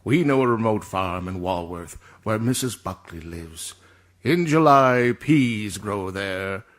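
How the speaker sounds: low, measured announcer voice; stately, slow-paced ad narration sinking at phrase ends; commercial read. Acted. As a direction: read the copy with grave gravitas. Reads a commercial script.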